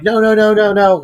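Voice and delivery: high panicked cries from the retold wife; retold panicked pleading. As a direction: imitate her begging him to stop. Wife steps between the two men.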